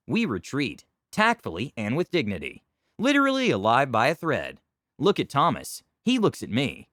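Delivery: ordinary delivery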